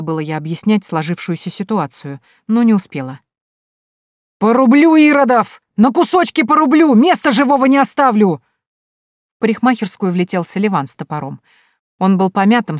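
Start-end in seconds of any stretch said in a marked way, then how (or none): 4.4-8.4 high, loud roar ready to fight; furious battle roar. A narrator's reading. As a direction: charge in swinging an axe. Selivan bursts in with axe. (яростный боевой рёв, громко и высоко)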